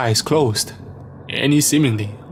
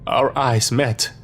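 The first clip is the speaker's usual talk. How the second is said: plain reading voice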